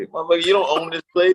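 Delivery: a hammed-up complaint about a stingy Chipotle server; mock indignant. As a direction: tell the server off.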